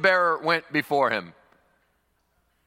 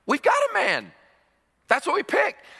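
much higher, excited claim; imitating eager soldier; 13 semitones higher than usual. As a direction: blurt it out eagerly. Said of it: "Someone would say".

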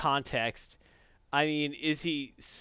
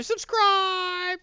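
excited shout; 5 dB louder than usual. Acted. Hyping viewers to subscribe.

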